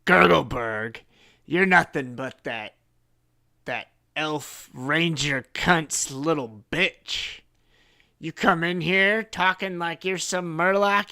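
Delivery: loud, mocking creature voice; sneering murloc chief. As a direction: tear him down with contempt. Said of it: "he says".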